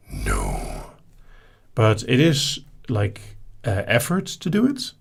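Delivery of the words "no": a much lower, growling dragon voice for a single "No.". Acted. Answering the dragon voice question, in a low dragon voice.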